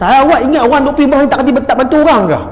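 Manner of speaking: fast, high-pitched indignant challenge; retold angry retort. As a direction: fire back like an offended critic. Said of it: "Ada orang marah".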